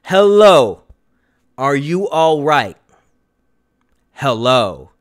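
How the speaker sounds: low, heavy horror-movie delivery; a hint of scared young man; dramatic Dave line. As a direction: make them feel the chill. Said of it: "I want you to feel this".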